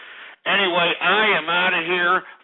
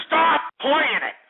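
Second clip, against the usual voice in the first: high, loud, slow furious command; angry; 9 semitones higher, 3 dB louder, slower than usual. Real, not acted. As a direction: demand it, furious. Host yells at caller.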